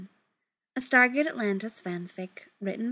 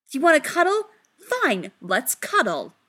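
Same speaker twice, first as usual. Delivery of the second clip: louder, higher, challenging; defiant outburst; 7 semitones higher, 6 dB louder than usual. Acted. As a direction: throw it back as a dare. Defiant agreement, grabbing partner.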